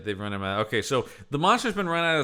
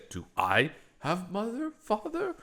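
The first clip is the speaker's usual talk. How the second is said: slow, halting, higher-pitched imitation; 7 semitones higher, 4 dB quieter than usual. Imitating the creature asking about parents, halting naive question. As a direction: ask haltingly, lifting high on the last word. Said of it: "he's doing, like, a".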